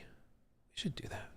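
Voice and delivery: soft unvoiced whisper. Real, not acted. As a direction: whisper it to yourself.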